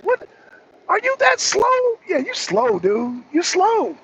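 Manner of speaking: high-pitched, incredulous jab; exasperated taunt. Real, not acted. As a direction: rise high in disbelief, scolding a slow listener. Berates viewer as slow.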